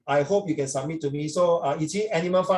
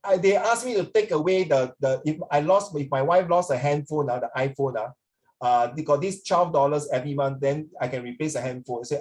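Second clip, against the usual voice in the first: ordinary delivery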